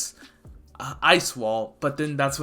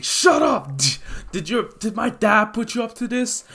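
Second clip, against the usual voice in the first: high, loud angry retort; angry outburst; 8 semitones higher, 3 dB louder than usual. Acted. Todoroki accuses Izuku of siding with his dad, angry. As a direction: snap back in rage.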